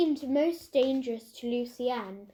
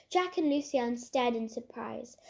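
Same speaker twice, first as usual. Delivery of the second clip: usual speaking voice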